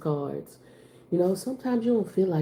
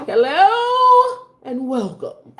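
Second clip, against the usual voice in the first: slow, loud, high preacher greeting; church voice; 9 semitones higher, 10 dB louder, much slower than usual. Shown as a demonstration. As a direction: stretch it out like a pastor in the pulpit. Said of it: "I know how to do that church voice".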